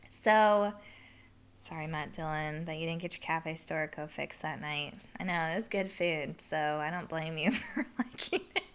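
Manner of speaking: ordinary delivery, nothing marked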